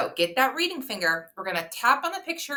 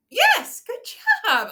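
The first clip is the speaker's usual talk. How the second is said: excited praise; 8 dB louder, much slower than usual. Acted.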